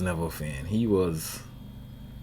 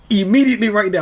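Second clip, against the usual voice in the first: exasperated outburst; 11 semitones higher, 7 dB louder than usual. Real, not acted. Exasperated rant.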